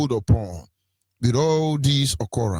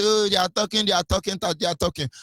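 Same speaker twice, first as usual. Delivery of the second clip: higher-pitched mock complaint, sinking with each repeat; mocking mimicry; 5 semitones higher, faster than usual. Speaker imitating people who complain about the talking, mocking mimicry. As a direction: mimic the critics dismissively.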